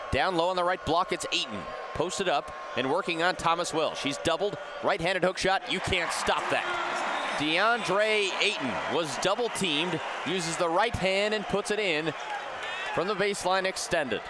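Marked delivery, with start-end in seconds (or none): none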